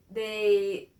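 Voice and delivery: ordinary talk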